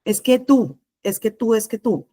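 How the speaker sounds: repetitive, mildly accusing interruption; aggressive. Shown as a demonstration. Teacher imitating someone who interrupts, mildly aggressive. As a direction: cut in and impose the blame. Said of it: "Impone, interrumpe".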